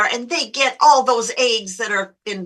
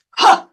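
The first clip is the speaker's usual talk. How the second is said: loud, short huff of outrage; imitated offended "huh!"; indignant huff; 8 dB louder than usual. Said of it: "and the woman does this".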